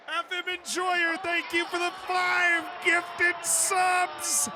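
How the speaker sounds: very high, loud, held-pitch shout; high hype holler of gratitude; big thank-you shout. Real, not acted. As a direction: shout out a donor's thanks, drawn out. Thanking a viewer for gifted subs.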